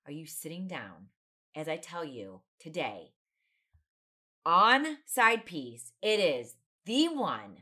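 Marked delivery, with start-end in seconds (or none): 4.5-7.6 slow, loud, drawn-out host announcement; announcer intro. Acted. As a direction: build up the guest name like a ring announcer. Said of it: "announcing her guest".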